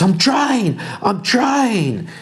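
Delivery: high strained voice, doing her best; high flustered archer. Acted. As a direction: pipe it out defensively. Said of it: "She goes".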